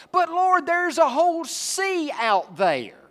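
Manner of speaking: high, loud, drawn-out objection; pleading Moses. Retold or imitated. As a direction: play Moses begging off at the sea. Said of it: "Moses objects".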